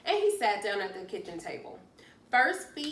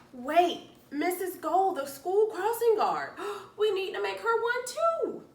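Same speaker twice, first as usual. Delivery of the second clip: higher and louder, urgent and thrilled; excited near-shout; 11 semitones higher, 6 dB louder than usual. Acted. Pete to Callie, remembering the crossing guard, excited near-shout. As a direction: blurt it out as a sudden idea. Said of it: "Pete practically shouted".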